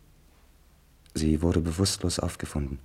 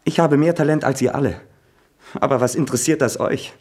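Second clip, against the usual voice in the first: higher and louder, shouting in rage; furious outburst; 6 semitones higher, 5 dB louder than usual. Acted. Son shouting furiously at his mother about her lover. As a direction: hurl every accusation at her.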